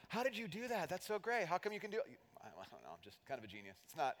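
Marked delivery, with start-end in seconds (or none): 0.1-2.2 admiring gush. Retold or imitated. Admirers voiced. 2.4-3.8 low, soft fake-modest brag; mock modest. Smug boaster voiced.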